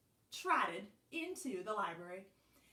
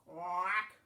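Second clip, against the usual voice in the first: a single quack; duck quack; 3 semitones lower than usual. A narrator's reading. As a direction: give one lone duck quack. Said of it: "She just heard".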